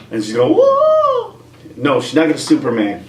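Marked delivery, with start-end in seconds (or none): 0.5-1.4 mock whoa. Flying through space.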